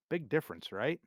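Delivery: ordinary delivery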